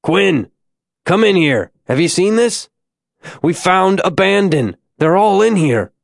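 excited shout into the distance, not full force; hollering. A narrator's reading. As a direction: holler across a cavern to someone. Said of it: "Lawrence hollered through the chamber".

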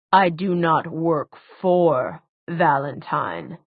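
slow, measured, emphatic denial; deliberate denial. A narrator's reading. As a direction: space out each word as you deny it. Villain denies the accusation.

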